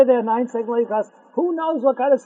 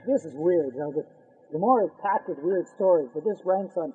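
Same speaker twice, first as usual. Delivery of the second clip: ordinary delivery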